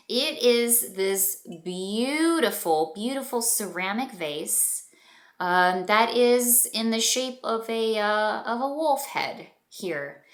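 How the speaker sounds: ordinary delivery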